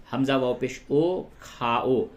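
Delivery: usual speaking voice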